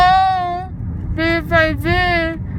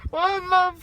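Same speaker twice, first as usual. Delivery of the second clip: ordinary talk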